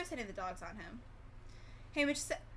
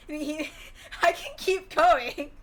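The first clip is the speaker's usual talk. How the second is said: louder and slow, laughing mid-sentence; amused at her own rambling; real giggle breaking into the words; 6 semitones higher, 11 dB louder, slower than usual. Real, not acted.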